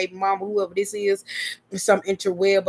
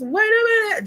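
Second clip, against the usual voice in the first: much higher, sudden squeal of alarm; startled squeal; 5 dB louder than usual. Retold or imitated. Vlogger retelling catching herself on video, startled squeal. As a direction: yelp it like you just caught yourself. Retelling her own reaction.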